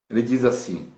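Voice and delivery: ordinary talk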